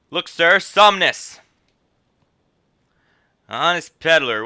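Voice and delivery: put-on stern guard scolding an old man. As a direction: catch the smuggler out. Voicing guards in cutscene.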